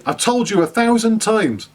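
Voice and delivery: a touch of a nagging mum; mum's exasperated scold. Retold or imitated. Teacher imitates his mother scolding him as a kid, mock exasperated.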